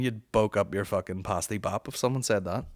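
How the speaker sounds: usual speaking voice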